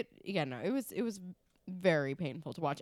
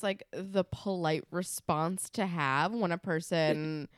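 ordinary talk, nothing marked

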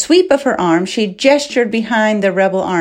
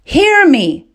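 high, raised call; calling out loudly; 9 semitones higher, 4 dB louder than usual. A narrator's reading. Princess gesturing for the soldiers' attention, calling out loudly. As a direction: demand to be heard. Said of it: "Aina called out loudly".

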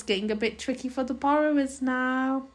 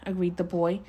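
ordinary delivery

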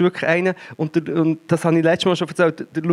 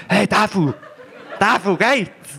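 calling name; 4 semitones higher, slower than usual. Retold or imitated. Friends waking her by name.

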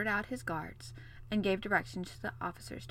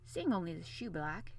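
usual speaking voice

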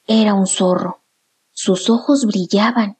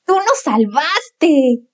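slow, higher thankful owlets; excited; 5 semitones higher, much slower than usual. Acted. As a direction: cheer it out slowly. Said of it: "exclamaron los dos hermanos".

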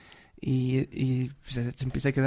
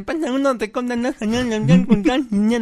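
high mock nasal mumbling; nasal passenger imitation; 7 semitones higher, 6 dB louder than usual. Friend imitates the nasal woman paying her fare, high. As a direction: garble the fare request like her. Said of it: "la gangosa le pasa su pasaje".

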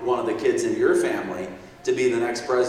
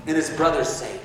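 ordinary talk

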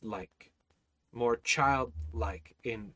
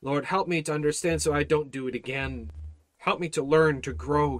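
ordinary talk, nothing marked

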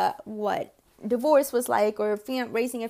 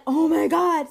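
excited gasp; 6 semitones higher, 8 dB louder, slower than usual. Retold or imitated.

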